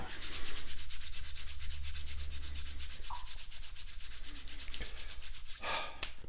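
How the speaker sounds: long breathy exhale; long sigh. Real, not acted. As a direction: let out a slow sigh before starting. Long breath before starting the lesson.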